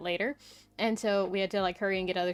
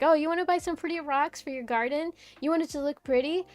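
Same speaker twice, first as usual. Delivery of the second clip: mock salesman pitch; 6 semitones higher, 4 dB louder than usual. Mocking garden store salesman.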